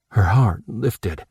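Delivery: ordinary delivery, nothing marked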